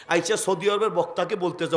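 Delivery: normal talking voice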